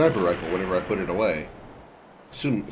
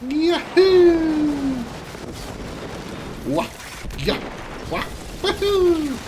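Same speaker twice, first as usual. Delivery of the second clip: high, loud cartoony jump yells; Super Mario jump sounds; 3 dB louder, much slower than usual. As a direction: whoop like a plumber leaping. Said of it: "I don't remember the Super Mario jump sounds".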